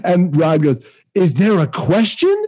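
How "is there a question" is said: stern official. Retold or imitated. Angry grad assistant voiced in story.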